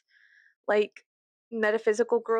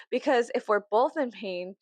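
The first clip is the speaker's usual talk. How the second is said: ordinary talk, nothing marked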